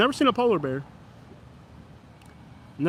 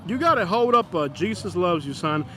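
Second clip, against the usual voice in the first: usual speaking voice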